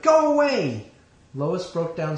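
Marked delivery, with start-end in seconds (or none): 0.0-0.9 screamed high and loud, full force; furious shout. A narrator's reading. Lois shouting at her betrayer Clark, high and loud. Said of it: "she shouted".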